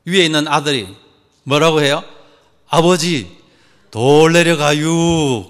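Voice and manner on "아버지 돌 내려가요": hugely stretched, unhurried warning; hammed-up slow Chungcheong son, dragging out the words; slow Chungcheong drawl. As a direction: draw every vowel out lazily. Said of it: "얼마나 이 충청도 분들이 느리는가".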